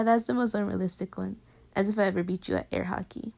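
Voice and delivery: ordinary delivery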